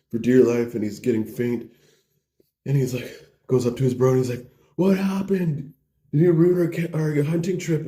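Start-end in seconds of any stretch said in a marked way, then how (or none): 4.8-7.9 high, distressed questioning; hammed-up upset demand; mock alarm. Bro finds his injured friend, questioning him in alarm.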